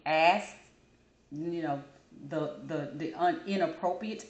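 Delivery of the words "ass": "ass" said loud and plain; the word ass. Shown as a demonstration. Vlogger teaching viewers to start from "ass". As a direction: say "ass" first, then add a k. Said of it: "as if I'm saying".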